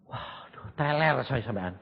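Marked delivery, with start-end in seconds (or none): 0.1-0.7 whispered waduh; stunned breath. Retold or imitated. Preacher recalling his shock, whispered dismay.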